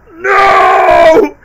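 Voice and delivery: long, high, very loud mock-sad "no"; over-the-top wail. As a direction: cry out in hammed-up despair. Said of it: "goes a bit over the top".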